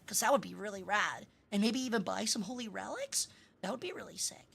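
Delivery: soft, close whispery ASMR voice; right in your ear. Pretend. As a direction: murmur it right into their ear. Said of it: "and I whisper in your ear".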